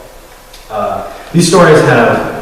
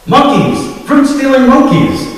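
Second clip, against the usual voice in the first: high, loud alarm call; crying out; 4 dB louder than usual. A narrator's reading. Rowan crying out a false alarm to the villagers, loud shout. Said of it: "he cried".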